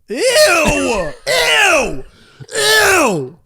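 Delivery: loud, high, laughing ew; disgusted shout. Acted. Reacting to short and poor.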